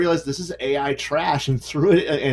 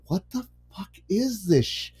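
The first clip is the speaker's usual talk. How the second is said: muttered disbelief; 3 semitones higher, 6 dB quieter than usual. Retold or imitated. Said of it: "you're sitting here going".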